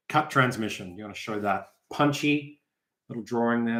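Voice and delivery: normal talking voice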